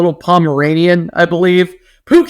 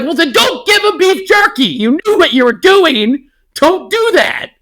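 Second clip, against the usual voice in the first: higher-pitched, exasperated scolding; exasperated retort; 11 semitones higher than usual. Retold or imitated. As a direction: scold her in mock disbelief, pitch jumping up. Retold exasperated reply to the mother.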